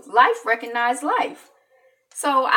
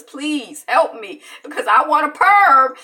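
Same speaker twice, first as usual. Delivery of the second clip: put-on pleading child; 3 semitones higher, 6 dB louder than usual.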